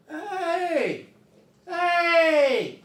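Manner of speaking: high, loud call into the night. Retold or imitated. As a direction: shout for help toward the motorcycle. Calling out to motorcyclist.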